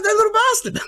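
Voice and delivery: very high squeaky indignant curse; outraged mock squeal. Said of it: "I'm like".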